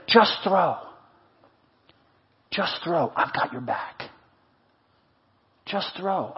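very soft, low reassurance; tender encouragement. Acted. Reassuring someone who has nothing left, tender and hushed. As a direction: drop right down, with long pauses between phrases.

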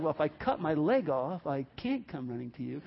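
understated answer, sinking at the end; deadpan reply. Acted. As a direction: drop down to a muted logical comeback. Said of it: "It's like".

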